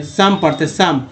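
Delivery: normal talking voice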